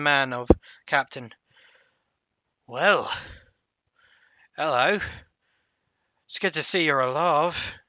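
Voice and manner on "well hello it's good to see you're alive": lightly voiced gruff old guard; a hint of a gruff old soldier; gruff guy. Half-orc greets the returning party, lightly gruff. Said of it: "It was a bit of a gruff guy".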